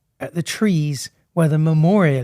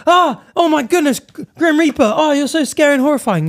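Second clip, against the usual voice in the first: high, breathless fake fright at the Reaper; mock terrified gasp; 8 semitones higher than usual. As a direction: squeal it like a scared dead soul. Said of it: "instead you go".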